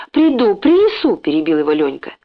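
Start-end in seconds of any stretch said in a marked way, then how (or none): 0.1-1.2 eager interruption. A narrator's reading. Boy eagerly interrupts the owner.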